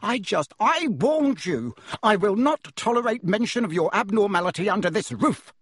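enraged uncle forbidding magic talk; raging. Acted. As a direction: spit every word in rage.

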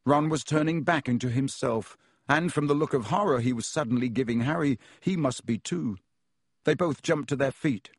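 plain reading voice

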